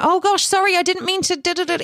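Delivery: high, hurried flustered apologising; flustered apology. Retold or imitated. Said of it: "would have been like".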